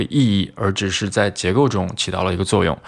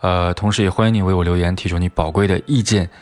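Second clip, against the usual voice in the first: fast, run-on outro; hurried sign-off; faster than usual. Real, not acted. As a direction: rush through the closing announcements. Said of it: "说话这么快吗". (结尾语速很快)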